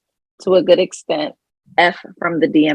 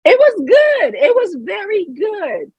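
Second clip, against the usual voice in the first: gloating; 11 semitones higher than usual. Real, not acted. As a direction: crow about the joke. Gloating over joke.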